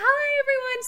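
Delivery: high, breezy hello; bubbly greeting. Retold or imitated. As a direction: chirp it like a friendly star. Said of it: "She's like".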